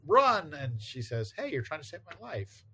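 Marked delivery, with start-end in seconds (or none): 0.1-0.5 higher-pitched imitated yell, loudest on "run"; mock yell. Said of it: "yells to her". 1.4-2.6 high, softened voice of dawning surprise; acted surprised realization. Comic heroine's realization acted out.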